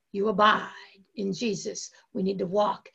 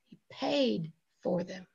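softer, falling away after a weighty 'paid'; understated statement; 3 semitones lower, 6 dB quieter than usual. Real, not acted. Preacher tells congregation of Jesus's payment, softly understated. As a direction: say it low-key, letting it settle. Preacher repeats line for emphasis.

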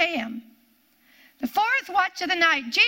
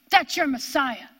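ordinary talk

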